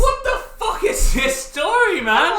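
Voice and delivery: high and loud exasperated shout; laughing exasperation; incredulous outburst. Real, not acted. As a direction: yell in disbelief. Outburst at the story.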